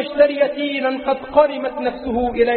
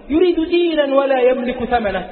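ordinary talk, nothing marked

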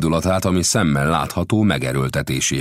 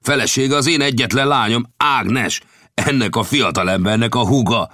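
a hint of an excited father, higher; Lang's voice; 10 semitones higher, 5 dB louder than usual. A narrator's reading. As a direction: tell them proudly about the daughter. Lang proudly explains family.

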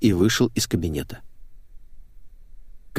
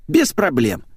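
higher-pitched, ready consent; easy agreement; 7 semitones higher, 3 dB louder, slower than usual. A narrator's reading. Сергей granting a request for equipment, easygoing. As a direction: agree without a second thought. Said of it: "легко согласился Сергей".

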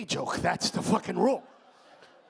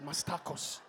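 put-on Mexican accent; 5 semitones lower, 7 dB quieter, slower than usual. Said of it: "That's what he said".

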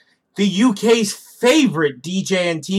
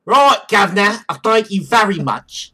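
put-on cockney accent; 3 semitones higher, 4 dB louder than usual. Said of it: "Accent [name]".

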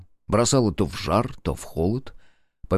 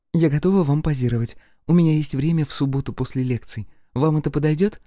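lightly higher coy lady's voice; 6 semitones higher than usual. A narrator's reading. Katerina flirting with Rudnev to spite Nikitin. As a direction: offer yourself sweetly, eyes on the rival.

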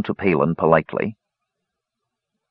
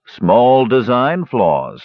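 slow, drawn-out sarcasm in a raised pitch; sarcastic; 5 semitones higher, 3 dB louder, much slower than usual. A narrator's reading. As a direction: stretch the words in mock understatement. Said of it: "said Sturm sarcastically".

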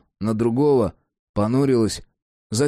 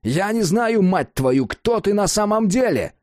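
shout; 10 semitones higher than usual. A narrator's reading. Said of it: "заорал Джек".

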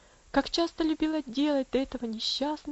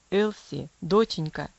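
low, sudden father's call; 5 semitones lower, 5 dB louder, slower than usual. Acted. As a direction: call her back suddenly. Father suddenly calls daughter. (низким голосом, неожиданно позвав дочь)

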